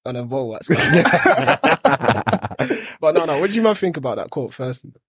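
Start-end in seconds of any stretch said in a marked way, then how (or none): 0.7-3.0 burst of laughter. Real, not acted. Laughs at own joke.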